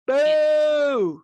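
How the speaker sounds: boo it like a heckler, drawn out; playful jeer. Pretend. Cohost jeering news of a rival march, playful booing.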